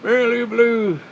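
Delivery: high, loud mock call; playful high-pitched teasing repeat; hammed-up silly name. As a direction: sing out the silly name, amused.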